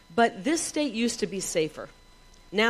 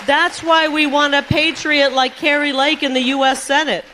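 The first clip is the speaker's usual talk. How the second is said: rally speech; 5 semitones higher, 6 dB louder than usual. Real, not acted. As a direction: shout it to the crowd. Rally crowd, building chant of reasons.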